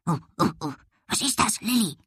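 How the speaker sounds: small dog yapping between words; woofing dog. A narrator's reading. Dog sniffs the air and asks, woofing. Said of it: "wuffte er".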